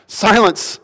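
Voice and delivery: high, firm parental command; parent scolding child. Acted. Speaking to a hurricane like an unruly child, firm parental command. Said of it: "parent would speak to an unruly child".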